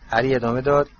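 ordinary delivery, nothing marked

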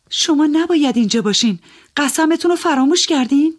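high, loud, urgent reproach; fast, pleading call to break the spell; calling out reproachfully. Acted. Woman calls the young gambler away from the table, high urgent reproach. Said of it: "صداش زدم".

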